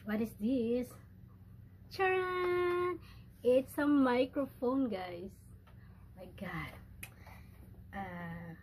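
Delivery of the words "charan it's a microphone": high ta-da, slow stretched announcement; triumphant reveal. Real, not acted. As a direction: unveil it with a gleeful, drawn-out flourish. Reveals the gift to viewers.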